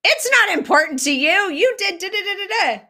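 high, loud mock outburst; hammed-up angry protest, rattling off the accusation; acted upset wife. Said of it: "And she might say".

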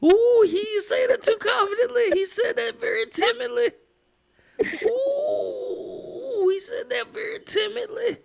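very high, gleeful hooting; high-pitched mock alarm; teasing squeal. Real, not acted. Mocking a timid answer.

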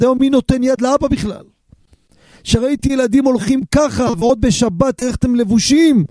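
high, tearful outpouring of a moved stranger; imitating a man crying with emotion; retold weeping man. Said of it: "ובוכה, דומע".

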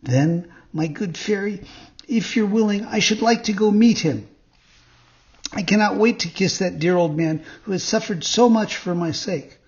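high, eager little puppet voice; joyful puppet. A narrator's reading. Puppet asks the fairy to meet his father, joyful and high. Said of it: "cried Pinocchio joyfully".

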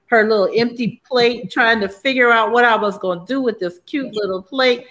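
ordinary talk, nothing marked

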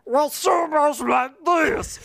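high, slow put-on accent; exaggerated accent-like character voice; high-to-low character voice. Shown as a demonstration. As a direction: stretch it out in a goofy high voice. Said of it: "throw an accent onto it".

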